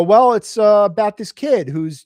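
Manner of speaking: flustered pitch. Retold or imitated. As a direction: launch in eagerly, stumbling over fillers. Retold second attempt at the pitch.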